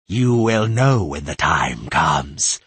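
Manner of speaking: slow, low, menacing mockery; cold mocking voice. The possessing voice mocking Tris, cold and hostile. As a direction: threaten her with cruel scorn.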